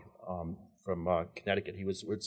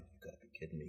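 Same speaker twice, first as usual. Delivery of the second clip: muttered disbelief; 6 semitones lower, 22 dB quieter, much faster than usual. Retold or imitated. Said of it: "and I'm like".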